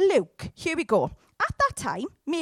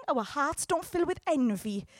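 ordinary talk, nothing marked

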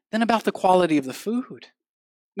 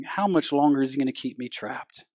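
acted bored grumble; 8 semitones lower, 7 dB quieter than usual. Bored listener watching clock, voiced.

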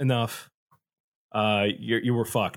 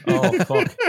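put-on Irish accent. Imitating an Irish guy staring at the flooded boat, light Irish accent. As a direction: curse the water in a lightly done Irish accent. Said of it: "I'm just gonna do the Irish".

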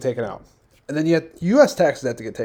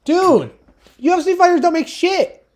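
heated yelling; 14 semitones higher, 5 dB louder than usual. Real, not acted. Heated argument, loud and high.

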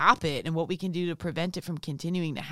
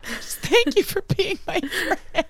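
laughing; 13 semitones higher, 4 dB louder, slower than usual. Real, not acted. Laughing thanks to a friend.

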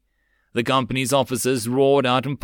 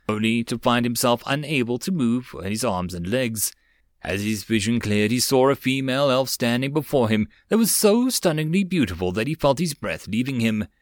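ordinary delivery

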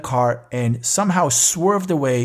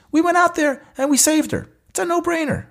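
much higher, passionate insistence; emphatic and much higher; imagined brothers' insistence. Retold or imitated. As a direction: voice the brothers' certainty, pitched well up. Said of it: "their look basically said the following".

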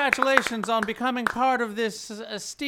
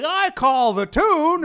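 domineering; 5 semitones higher, 5 dB louder than usual. Acted. Lincoln asserts power.

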